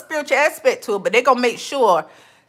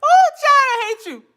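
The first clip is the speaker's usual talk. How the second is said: mock hater; 12 semitones higher, 4 dB louder than usual. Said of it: "voicing the haters".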